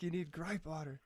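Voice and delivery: Irish lady voice, only lightly put on; a hint of an Irish accent, half-hearted; bad Irish accent. Retelling the Irish church lady's advice, faint Irish accent. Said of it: "It's pretty shitty".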